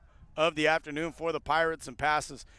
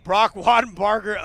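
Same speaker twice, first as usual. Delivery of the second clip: louder, higher, stretching out Brock; excited name call; 4 semitones higher, 9 dB louder than usual. Real, not acted. Announcer crediting the tackler to listeners, excited call. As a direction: shout the player's name in triumph.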